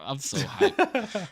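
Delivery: high, slow and stretched out; high slow hyped squeal. Real, not acted. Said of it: "repeats own hype line".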